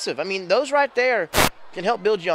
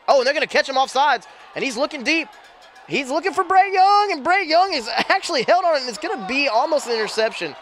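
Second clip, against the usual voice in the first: rising excited call, higher and louder; excited play-by-play; 6 semitones higher, 3 dB louder than usual. Real, not acted. Live call of a big play.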